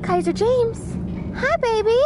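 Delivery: high cooing hello to her recovering dog. Real, not acted. Greeting her dog sweetly.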